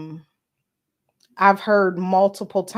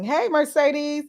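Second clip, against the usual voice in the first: ordinary talk, nothing marked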